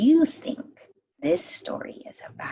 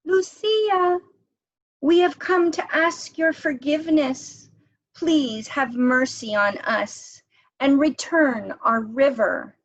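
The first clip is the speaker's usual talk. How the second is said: calling out; 8 semitones higher, 5 dB louder than usual. Acted. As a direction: voice raised high and louder, pleading. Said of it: "And then two children called out".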